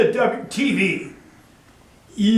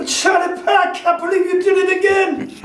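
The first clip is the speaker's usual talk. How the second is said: angry shout; 11 semitones higher than usual. Retold or imitated. Sheik yelling at Pat over the fifty bucks, high angry shout. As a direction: snap at him, outraged, up high.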